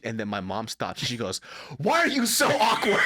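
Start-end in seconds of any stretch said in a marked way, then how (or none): 1.8-3.0 high, loud mock scolding; exasperated mom. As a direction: imitate mom blowing up at dad. Mom blows up at dad.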